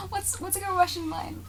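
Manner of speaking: ordinary delivery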